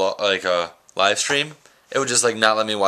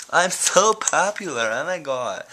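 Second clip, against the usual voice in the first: mock smug; 7 semitones higher than usual. Mock bragging about text messages.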